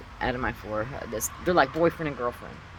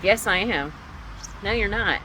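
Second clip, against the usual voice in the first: higher-pitched retold bickering; arguing; 7 semitones higher than usual. Dreamer and mother contradict each other, arguing. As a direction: act out a yes-no squabble. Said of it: "And it's an argument about".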